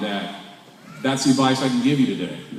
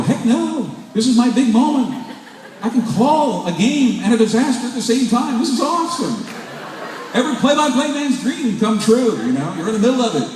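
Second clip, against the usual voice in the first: high, laughing, thrilled to be there; he's over the moon, can't stop grinning; gleeful excitement, laughing; 9 semitones higher than usual. Retold or imitated. Refusing the crowd urging him out, high and laughing.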